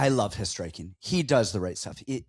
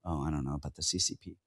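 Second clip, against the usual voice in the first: dropped low and soft, a quick throwaway mutter; low mumble; 9 semitones lower, 9 dB quieter, faster than usual. Acted. Co-host tossing off a CCP joke, low quick mumble. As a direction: mumble it under your breath, low and fast.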